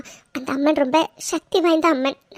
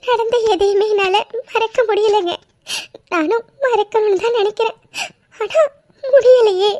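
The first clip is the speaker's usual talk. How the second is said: high, sobbing and pleading; broken-voiced grieving wife; crying; 8 semitones higher than usual. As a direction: cry out that she can't forget. Wife can't forget the past.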